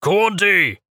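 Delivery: high, loud call in acolyte voice. A narrator's reading. Acolyte stalking past the cart, calling the priest loudly. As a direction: call the name out sharply.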